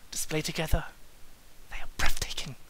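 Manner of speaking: breathless whisper; hushed, amazed whisper; awed whisper. Acted. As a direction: whisper it in awe. Whispered awe.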